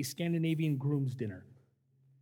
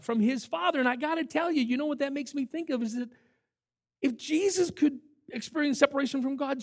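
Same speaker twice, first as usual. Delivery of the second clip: ordinary delivery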